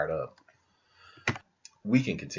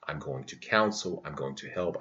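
ordinary talk, nothing marked

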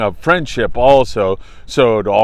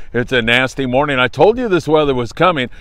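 ordinary talk

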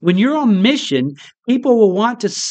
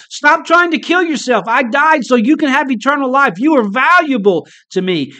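Jesus screaming; 7 semitones higher than usual. Retold or imitated. Preacher voicing Jesus to the suicidal, high urgent plea. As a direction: call it out high, as a rescue plea. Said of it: "Jesus' action screams from the Bible".

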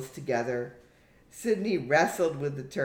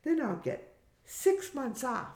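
put-on very high girlish voice; high-pitched young animator; Hamish, young daughter; 9 semitones higher than usual. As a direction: explain it to the grown-ups in a high daughter's voice.

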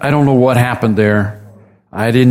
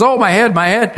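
a lightly voiced boy crying out, higher; 8 semitones higher than usual. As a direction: act out the boy clutching his aching head. Sick boy cries out in field.

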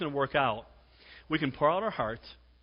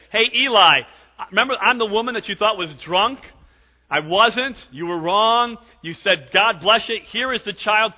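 loud hailing; 7 semitones higher, 11 dB louder than usual. Acted. Acts out Hannah hailing Eli.

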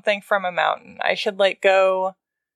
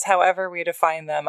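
ordinary talk

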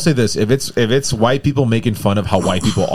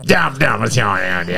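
Waterboy voice; slower than usual. Retold or imitated. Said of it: "the Water Boy dude".

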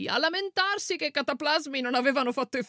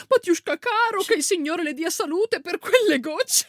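fawning peasant woman; 7 semitones higher, 4 dB louder than usual. Retold or imitated. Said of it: "E giù con".

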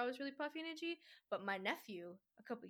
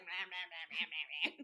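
put-on little kid gobbling noises; mock munching noises; 4 semitones lower than usual. Recalling herself tiny at berry picking, mock munching. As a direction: imitate greedy munching, nonsense syllables.

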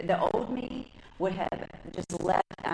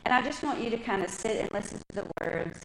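ordinary delivery

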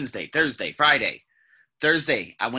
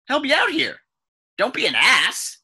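louder, higher mock plea to a doctor; raised-voice, exasperated appeal; exasperated retort; 11 semitones higher, 5 dB louder than usual. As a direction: push it out in retold frustration.

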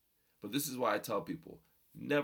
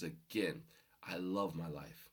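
ordinary talk, nothing marked

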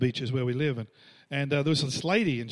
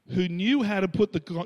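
ordinary talk

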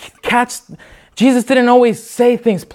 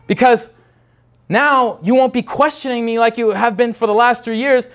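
ordinary talk

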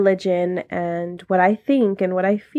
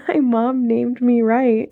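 breaks into a real laugh on it, a touch higher; 4 semitones higher than usual. Real, not acted. Host laughing as she admits the name fits. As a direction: laugh it out, amused at herself.

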